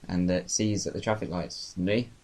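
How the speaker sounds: ordinary talk, nothing marked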